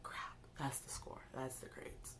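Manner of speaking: soft unvoiced dread; dreading whisper. Seeing the grades arrive, a soft dreading whisper. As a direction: whisper it, afraid to look.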